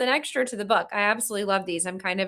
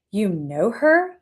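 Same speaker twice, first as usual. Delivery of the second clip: slow, drawn-out question of disbelief; incredulous; 2 semitones lower, 5 dB louder, much slower than usual. A narrator's reading. Co-star learning she knows the brunette, incredulous. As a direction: stretch it out, mildly incredulous. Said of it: "He looked down at me incredulously".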